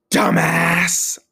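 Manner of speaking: sharp loud yell of Dumbass; yelled insult. Retold or imitated. Angry mother shouting at the guy on the stuck escalator. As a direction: bark it at him like an angry stranger. Woman yells at speaker.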